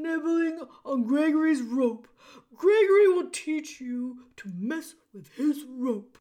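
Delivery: loud, stammering gruff jailer; bellow, tripping over the words; booming jailer voice. A narrator's reading.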